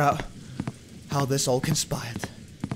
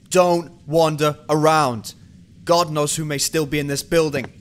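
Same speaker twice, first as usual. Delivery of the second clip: stern warning; 2 semitones higher, 7 dB louder than usual. Acted. Detective warning his officers.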